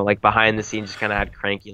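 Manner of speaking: ordinary talk, nothing marked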